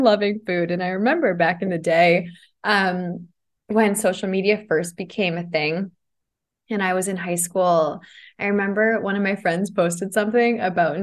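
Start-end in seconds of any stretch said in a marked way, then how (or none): none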